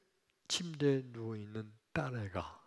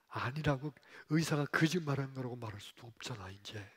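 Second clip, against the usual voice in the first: normal talking voice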